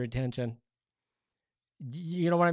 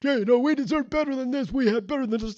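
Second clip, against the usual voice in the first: much higher, louder mock outrage; hammed-up entitled indignation; indignant; 13 semitones higher, 8 dB louder than usual. Complainers getting in God's face, indignant. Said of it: "get in his face and said".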